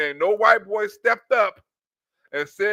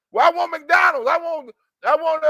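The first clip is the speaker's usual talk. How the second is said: higher, louder yelled food demand; mock shouted demand; 6 semitones higher, 4 dB louder than usual. Mimicking the train man yelling for McDonald's, high and loud. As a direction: yell it like a tantrum.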